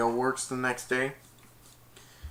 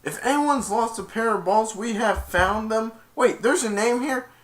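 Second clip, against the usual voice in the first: high, loud mock PA announcement; higher, louder fake intercom voice; announcer voice; 11 semitones higher, 4 dB louder than usual. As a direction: play the smug announcer reading a prank page. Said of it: "Kirk said".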